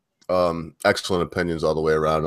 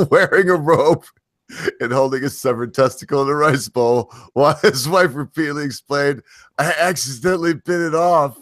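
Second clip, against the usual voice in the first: laughing; 6 semitones higher than usual. Real, not acted. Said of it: "without laughing".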